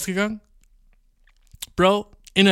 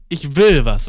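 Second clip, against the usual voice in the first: defiant burst; 8 dB louder, slower than usual. Real, not acted. Childish insistence.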